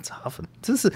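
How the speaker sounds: a teasing whispered jab; whispered aside. Real, not acted. Muttering about co-host being a fan, in a whisper. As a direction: whisper it under your breath.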